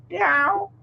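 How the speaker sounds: high playful cat meow; high teasing cat meow. Pretend. Imitating the cat.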